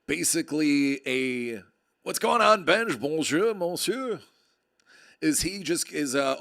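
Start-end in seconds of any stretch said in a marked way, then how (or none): 2.0-4.2 high, loud mocking hello; hammed-up bonjour greeting. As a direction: toss off a cheesy French greeting. Mock greeting voice to Benj.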